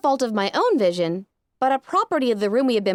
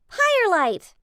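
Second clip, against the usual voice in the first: high, joyful cheer; 9 semitones higher, slower than usual. Acted. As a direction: cheer her name in delight. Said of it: "I cheered".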